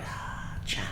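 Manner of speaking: soft whispered awe; awed whisper. Retold or imitated. Watching the sushi master prep fish, a soft whispered awe. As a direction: breathe it in quiet admiration. Admiring boss prepping fish.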